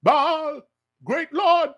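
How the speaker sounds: high, stretched mock invocation; calling on Baal. False prophets calling upon their god, mock pleading cry. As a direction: call out high to a god, mocking. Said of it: "they were calling upon the Most High".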